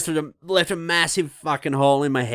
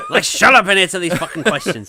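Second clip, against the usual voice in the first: laughing mock complaint; 6 semitones higher, 7 dB louder than usual. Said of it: "Getting these questions come through".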